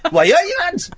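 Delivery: high, hammed-up Geordie cry; exaggerated high Geordie catchphrase; Geordie accent. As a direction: pitch it way up in broad Newcastle.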